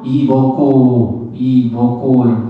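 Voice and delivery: 이 먹고 said slowly in Gyeongsang dialect. Shown as a demonstration. Monk demonstrating the hwadu 이 먹고, slow Gyeongsang dialect. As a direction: drawl 이 먹고 like a Gyeongsang local. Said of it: "경상도 사투리로".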